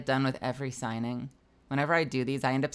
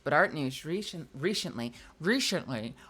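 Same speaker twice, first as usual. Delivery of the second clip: normal talking voice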